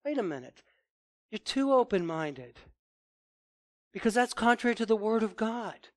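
ordinary delivery

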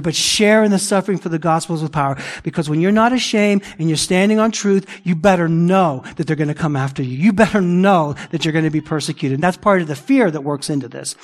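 ordinary talk, nothing marked

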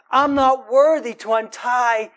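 preacher proclaiming John's words; emphatic quote. Acted. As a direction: declare it slowly with force. Preacher voices John's humility.

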